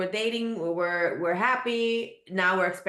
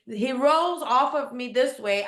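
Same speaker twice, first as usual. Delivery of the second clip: usual speaking voice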